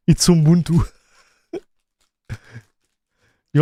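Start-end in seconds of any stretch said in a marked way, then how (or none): 0.1-0.9 Ubuntu said as "oom-BOON-too", with an m before the b, falling; his own way of saying Ubuntu. Shown as a demonstration. Answering a viewer who asks why he says Ubuntu, with a laugh and his own oom-boon-too. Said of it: "'Cause that's the way I wanna call it".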